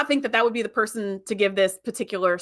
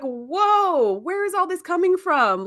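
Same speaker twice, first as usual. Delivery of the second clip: drawn-out "whoa", then higher question; taken aback; 7 semitones higher, slower than usual. Retold or imitated. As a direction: imitate someone startled by an outburst. Tom taken aback by rant.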